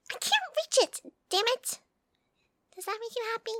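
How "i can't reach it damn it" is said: high, loud exasperated cry; frustrated outburst. Acted. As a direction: let the frustration burst out. Struggling to reach the counter.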